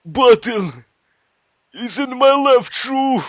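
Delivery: hammed-up dying soldier; mock dying Russian. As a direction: hand over the secret with final breath. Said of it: "He's like".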